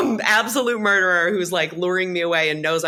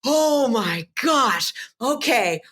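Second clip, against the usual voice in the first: mock amazed; 4 semitones higher, much slower than usual. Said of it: "And I was like".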